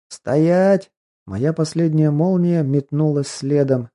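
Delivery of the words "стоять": loud, high stop order; commanding shout. Acted. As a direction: bark the command to halt. Commanding her to halt.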